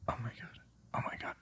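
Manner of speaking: hushed, breathless "Oh my God"; whisper. Real, not acted. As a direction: drop to a whisper, voiceless and secretive. Mocking the woman, then aside.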